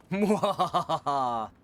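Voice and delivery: slow, hammy villain cackle; evil laugh. Pretend. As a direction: cackle like a mad scientist.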